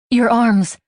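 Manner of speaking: "Your arms" blurted out high and loud. A narrator's reading. Haley blurting to Mike what she finds hot, high and loud. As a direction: let "Your arms" burst out before thinking. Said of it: "I blurt".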